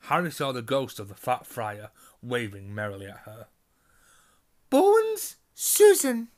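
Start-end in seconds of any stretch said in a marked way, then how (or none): 4.7-6.3 high, loud stern roll call; calling a name. A narrator's reading. McGonagall calls the next student to the stool. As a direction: call the name out across the hall.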